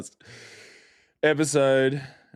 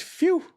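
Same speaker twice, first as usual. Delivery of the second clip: very high mock "phew"; imitated sigh of relief. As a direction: blow out a high-pitched, hammed-up phew. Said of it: "And she goes".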